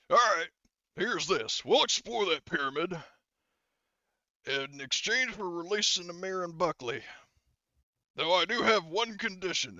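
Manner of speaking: acted soldier negotiating. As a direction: lay out terms loud and high. GM voices gruff Gunny making a deal.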